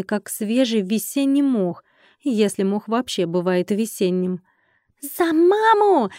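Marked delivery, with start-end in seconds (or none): 5.0-6.1 high, squeaky drawn-out war cry; troll battle cry. A narrator's reading. Baby troll leads the charge upstairs, squeaky battle cry. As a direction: shout it like a tiny charging critter. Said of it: "прокричал самый первый штроль".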